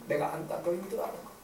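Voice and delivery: soft, understanding murmur; tender. Acted. Parent embracing a struggling child, tender. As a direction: comfort them quietly.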